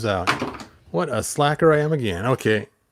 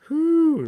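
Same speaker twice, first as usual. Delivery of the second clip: high whew; 4 dB louder than usual. Real, not acted. Reacting to green stench.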